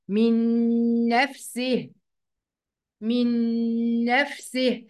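very slow "من نفسه" as minnn-nafsih; one doubled nun. Shown as a demonstration. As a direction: hold a long humming n in "من نفسه". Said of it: "وهننطق نون واحدة مشددة".

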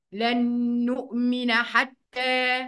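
slow "لن نؤمن حتى" as lannn-nu'mina hattaa; nasal ghunnah. Shown as a demonstration. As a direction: hum the held n in "لن نؤمن". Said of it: "دي غنة".

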